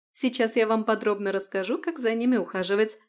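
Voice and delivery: ordinary delivery, nothing marked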